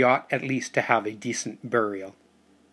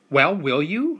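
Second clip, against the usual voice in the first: higher and louder, pressing for an answer; persistent; 6 semitones higher, 4 dB louder, slower than usual. Acted. Boy chases his uncle for an answer, higher and insistent. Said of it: "I persisted".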